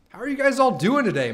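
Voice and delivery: high, loud hyped greeting; excited greeting. Real, not acted. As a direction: call it out high and loud to the chat.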